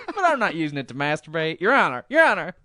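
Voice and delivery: hammy Perot pleading; Ross Perot voice. Retold or imitated. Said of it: "my Ross Perot voice".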